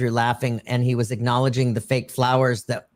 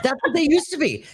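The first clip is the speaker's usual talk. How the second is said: high pitched, laughing through the words; 13 semitones higher, faster than usual. Real, not acted. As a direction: laugh while you say it. Laughing at dollar store flowers.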